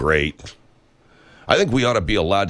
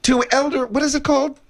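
mock high voice.

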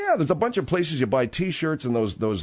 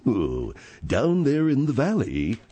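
slow, spooky true-crime narrator; Keith Morrison voice; slower than usual. Retold or imitated. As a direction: draw it out like a creepy documentary host. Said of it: "but he's got that voice".

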